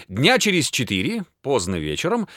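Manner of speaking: plain reading voice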